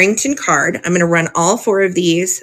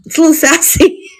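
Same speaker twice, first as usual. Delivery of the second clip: quick, higher giggly line; laughing; 3 dB louder, much faster than usual. Real, not acted. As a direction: laugh through the word sassy. Giggles over sassy card.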